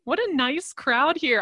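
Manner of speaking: ordinary talk, nothing marked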